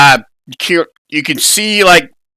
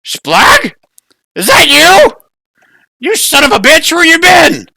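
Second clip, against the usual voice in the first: very high, loud goblin shout; a goblin yelling at an old friend; 5 dB louder than usual. Acted. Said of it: "you hear a voice".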